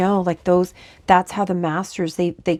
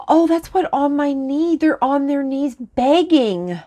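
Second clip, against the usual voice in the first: high, louder, slowing awe; rising up in amazed emotion; moved exclamation; 8 semitones higher, 4 dB louder, slower than usual. Real, not acted. In awe that the spirits beg on their knees.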